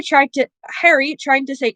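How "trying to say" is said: usual speaking voice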